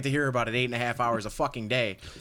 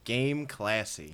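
slow and flat-out bored; slow deadpan drawl; 2 semitones lower, much slower than usual. Acted. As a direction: stretch game classy out slowly, bored. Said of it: "That's not what you're supposed to do".

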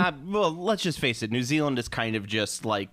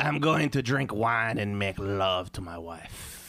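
put-on slow, lazy French accent; mock French postman; 4 semitones lower, slower than usual. Mocking the European post, a slow French accent.

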